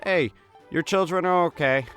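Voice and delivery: ordinary talk, nothing marked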